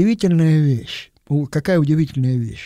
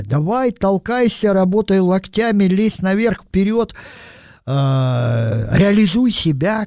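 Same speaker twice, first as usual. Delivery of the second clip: higher-pitched mock go-getter slogans; urging in a raised, insistent pitch; pushy urging; 5 semitones higher than usual. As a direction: egg them on, imitating a pushy motivator. Voices today's pushy self-promotion slogans.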